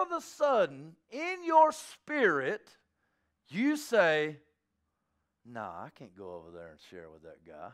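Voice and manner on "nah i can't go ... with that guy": low, soft mumbled excuse; reluctant inner voice. Pretend. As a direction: talk yourself out of it under your breath. Voicing reluctant inner thought.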